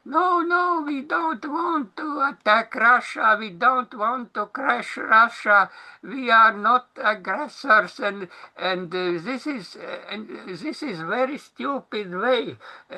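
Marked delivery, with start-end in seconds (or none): 0.0-8.0 high, hammed-up plea of denial; mock pleading politicians. Speaker mocks Western politicians pleading to Russia, high and hammed-up. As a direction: whine it like a scared diplomat, mockingly. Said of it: "Western politicians are crying".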